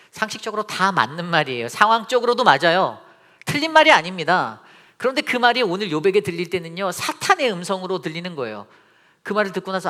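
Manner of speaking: loud, high, emphatic pulpit voice; ringing preacher's proclamation; full-force preaching. Real, not acted. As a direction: drive each point home loudly.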